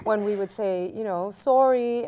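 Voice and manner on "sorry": "Sorry" with a Canadian vowel, slightly raised; Canadian accent. Shown as a demonstration. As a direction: let the Canadian sound slip out on "Sorry". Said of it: "some of our Canadian sounds coming out".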